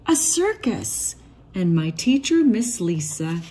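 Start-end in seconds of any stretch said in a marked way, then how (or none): none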